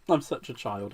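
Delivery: usual speaking voice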